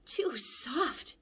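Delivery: whispered, short of breath; breathless whisper. Acted. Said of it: "she said".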